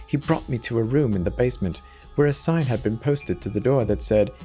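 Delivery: ordinary delivery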